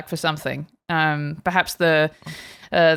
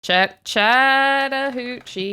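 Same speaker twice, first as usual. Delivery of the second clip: drawled title; 6 semitones higher, much slower than usual. Shown as a demonstration.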